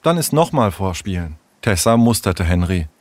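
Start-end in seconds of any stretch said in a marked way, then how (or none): 0.0-1.4 tentative, lightly voiced child; undecided. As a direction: weigh it up, unsure of yourself. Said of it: "Henry wirkte unentschieden".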